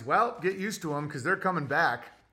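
normal talking voice

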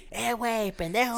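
high, teasing "güey, pendejo"; imitating friendly insult. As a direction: toss the insult out like a joke. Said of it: "ellos se refieren así conmigo".

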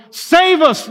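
high, loud crowd cry; preacher voicing the crowd's high desperate shout. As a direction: cry out like the pleading crowd at Jerusalem. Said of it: "That's what they were shouting".

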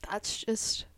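normal talking voice

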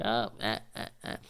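halting, soft awkward little noises; mock awkward. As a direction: act super surprised and awkward, haltingly. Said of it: "like I'm awkward".

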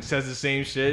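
usual speaking voice

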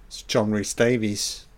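usual speaking voice

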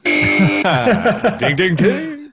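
high laughing ding ding ding; laughing quiz bell. Real, not acted. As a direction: chime it out while laughing.

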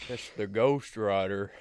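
slow, drawn-out cowboy voice; Sam Elliott. Acted. Co-host imagines the old dog talking, slow Sam Elliott impression. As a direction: draw it out like a western film legend. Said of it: "Sam Elliott voice".

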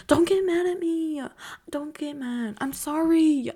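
mock whiny plea; cutesy pleading. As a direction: beg forgiveness in a tiny voice.

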